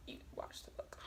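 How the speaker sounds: hushed whisper mocking her own slip; whispered aside. Real, not acted. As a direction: whisper it to yourself, teasing.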